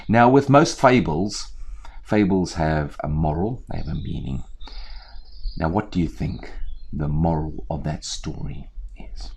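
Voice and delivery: ordinary delivery, nothing marked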